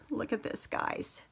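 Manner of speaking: soft whispered aside. Real, not acted. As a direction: whisper it to viewers. Showing fabric softly.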